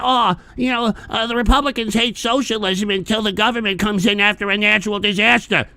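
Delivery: lightly voiced, sneering parody of a socialist; mocking socialists. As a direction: parrot their argument with mild scorn. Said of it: "these socialists try to claim".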